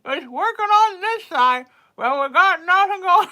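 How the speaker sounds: high, loud, half-numb mouth; mock lopsided lip talk. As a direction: show off the dead side of the mouth. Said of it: "and I'm like".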